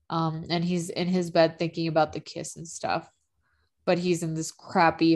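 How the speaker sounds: ordinary talk, nothing marked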